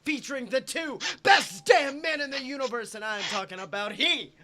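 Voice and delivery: loud, booming circus ringmaster. Acted. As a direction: announce it to the big top. Circus intro for host.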